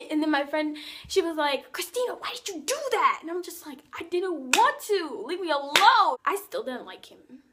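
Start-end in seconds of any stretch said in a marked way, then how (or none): 1.7-3.2 high-pitched imitation of a reproachful friend; mock scolding friend. Friend scolds her, acted. 4.0-6.2 defensive retort. Retold or imitated. Acted defensive retort to friend.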